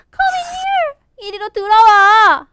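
little fish calling a friend inside; high storybook beckoning cry; urgent calling. Acted.

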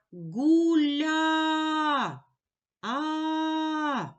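Гуля then a long held А; sound stretching. Shown as a demonstration. Teacher isolates the final vowel, held long. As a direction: stretch each sound, holding the А. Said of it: "Позначаю двома рисочками".

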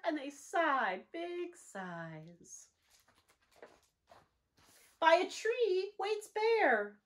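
lively, sing-song storybook reading; animated picture-book narration with slow hushed refrains; reading to children. A narrator's reading. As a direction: read it like storytime for little ones. Reads picture book aloud.

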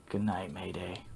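soft, whispered farewell; very quiet, breath-only parting; hushed goodnight. Acted. Parting after the quarrel.

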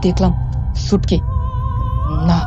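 usual speaking voice

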